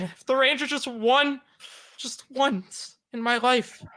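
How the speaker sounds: high, wistful plea with dramatic pauses; wounded fan pouring it out, high and halting; mock-emotional. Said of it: "I'm about to cry".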